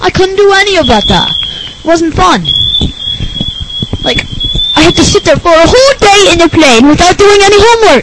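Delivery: high, rushed, indignant character whine; whining nerd. Acted. Nerd guest laments a day without homework, whiny outrage.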